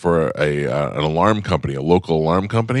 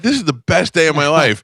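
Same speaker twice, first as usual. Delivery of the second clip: high, louder gushing; overjoyed; a fan who can't believe his luck; mock delight; 9 semitones higher, 4 dB louder than usual. His own delighted retold thought.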